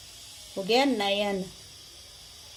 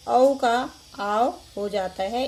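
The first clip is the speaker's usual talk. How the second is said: dictating slowly; 4 dB louder, slower than usual. Shown as a demonstration. Teacher dictates rule slowly.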